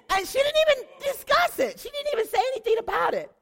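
outraged disbelief. Real, not acted. Telling the audience the apostle ignored her, outraged. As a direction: burst out in indignant shock.

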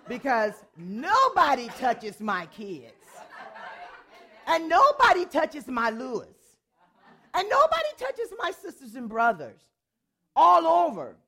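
loud, rising defiance, each nobody hammered; fierce protective outburst; defiant declaration. Retold or imitated. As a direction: stand your ground and declare it.

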